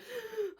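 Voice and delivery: sharp high gasp, caught off guard; mock overwhelmed gasp. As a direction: gasp as if swamped by offers. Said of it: "you're like".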